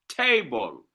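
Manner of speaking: table with a strong ta- and a light -ble; stress on first syllable. Shown as a demonstration. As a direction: hit the start of table, let -ble drop. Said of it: "a stressed syllable followed by an unstressed".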